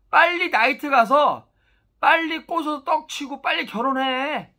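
put-on loud, high, pushy urging to young people; urging advice. To young people, about marrying fast: loud, high urging. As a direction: shout the advice at the young crowd like a rowdy uncle. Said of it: "젊은 친구한테 항상 얘기하는 게 뭐냐?".

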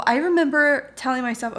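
ordinary talk, nothing marked

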